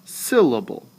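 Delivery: SYL-la-ble, strong then weak, weak; stress on first syllable. Shown as a demonstration. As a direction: hit SYL and drop through Syllable. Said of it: "Same thing".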